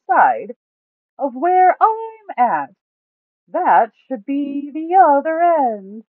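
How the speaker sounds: high, loud, slow voice of big Duke; booming Duke. A narrator's reading. Duke laying out the plan to the party, loud and slow. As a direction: call it out big and slow, stretching every word.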